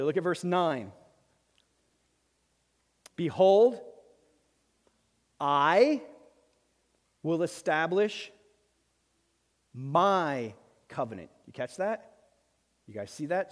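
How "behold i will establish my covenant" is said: slow, deliberate, each word set apart; slow reading. Shown as a demonstration. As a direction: read it slowly with long pauses between words. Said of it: "slow down and read it slowly".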